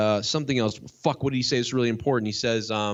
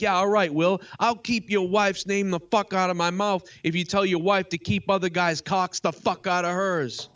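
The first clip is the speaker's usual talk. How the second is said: mock comeback; 7 semitones higher than usual. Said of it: "He should have been like".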